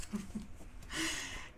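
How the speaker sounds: soft breathy chuckle; quiet real laughter; giggle. Real, not acted. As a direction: giggle at your own cheeky remark. Laughs at own wordplay.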